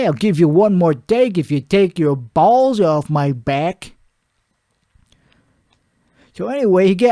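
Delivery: usual speaking voice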